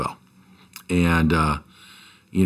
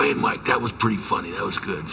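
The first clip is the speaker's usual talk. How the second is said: lightly imitated player's voice, softer; a hint of the player's own voice, trailing softer; 4 dB quieter than usual. Coach retelling his player's reply to the prank, light impression. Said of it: "He goes, in his voice".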